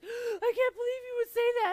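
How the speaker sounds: loud, high-pitched mock wail after a gasp; shrieking fake sob; imitated meltdown. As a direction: cry it out hysterically, hammed up.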